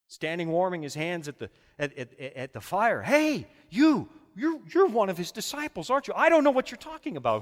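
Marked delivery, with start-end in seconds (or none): 3.0-6.1 accusing bystander. Acted. Acted courtyard accusation. 6.1-7.4 flustered denial. Acted. Peter's acted denial.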